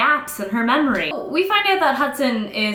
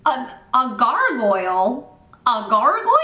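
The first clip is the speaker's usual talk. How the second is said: baffled, stretched-out outrage; incredulous. Real, not acted. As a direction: repeat it in sheer disbelief. Incredulous at the plot twist.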